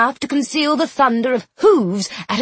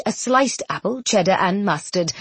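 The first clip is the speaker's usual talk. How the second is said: ordinary delivery, nothing marked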